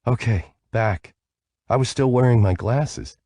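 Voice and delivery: slightly breathless, airy. A narrator's reading. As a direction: say it short of breath. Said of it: "came back with a breathless".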